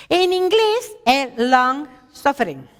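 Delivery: "long suffering" leído despacio y más agudo, con acento hispano; English term. Shown as a demonstration. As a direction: decir "long suffering" en inglés, lento y cuidadoso. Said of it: "¿Así se pronuncia?". (pronunciando "long suffering" en inglés, despacio)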